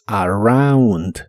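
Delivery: "around" drawn out, ow in the middle; slow model word. Shown as a demonstration. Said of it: "se pronuncia como au".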